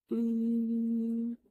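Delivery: long, drawn-out thinking hmm; thoughtful hum. A narrator's reading. Meera pondering the time with a long hmm. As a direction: hum slowly while working out the time. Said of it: "अपने दिमाग में सोचने लगती".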